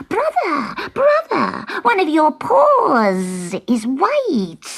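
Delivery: a shocked little cat crying out; hissing alarm. Acted. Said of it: "Sutika hissed".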